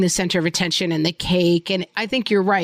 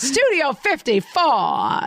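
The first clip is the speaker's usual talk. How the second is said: slow, higher-pitched mock-glamorous drawl; hammed-up club reveler; 6 semitones higher, slower than usual. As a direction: echo the joke in a put-on scenester voice. Echoes the other's put-on club voice.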